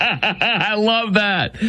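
high, drawn-out laughing approval; laughing delight. Real, not acted. Host cracking up at the president's put-down, laughing delight. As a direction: say it through laughter, slowly and high.

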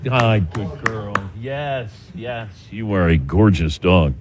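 slow, soft and low, cooing to the dog; talking softly to a good dog. Real, not acted. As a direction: greet the dog gently like a pet owner.

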